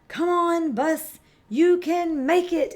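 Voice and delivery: high, loud cheer, a bit slow; rally the bus up the hill. A narrator's reading. Children cheer the bus up the steep road, excited. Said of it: "everyone cheers".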